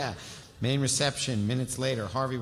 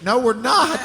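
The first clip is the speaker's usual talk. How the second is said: much higher and louder, mock indignant; loud playful no; 11 dB louder, slower than usual. Real, not acted. Refusing to skip a favourite scene, loud and playful.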